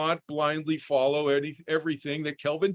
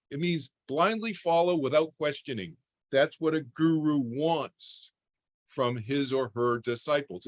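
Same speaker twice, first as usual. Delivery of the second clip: normal talking voice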